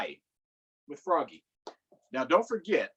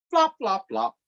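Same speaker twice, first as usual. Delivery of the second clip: high flop sound words; 6 semitones higher, 4 dB louder than usual. A narrator's reading.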